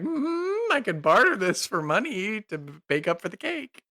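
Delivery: bright squeaky plea, offering her egg; eager hopeful offer. Acted. Agape offers the baker an egg to pay, high and eager.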